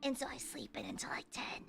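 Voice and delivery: breathy unvoiced whisper, very soft. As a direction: let it drop to a secretive whisper. Confiding she sleeps in late.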